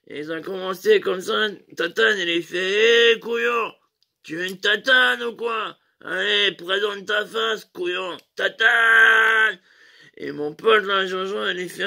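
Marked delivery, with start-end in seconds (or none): 3.1-9.6 rowdy mate shouting a dare at his friend; shouted provocation. Retold or imitated. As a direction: goad him, end in a drawn-out yell. Tatane provokes Jojo.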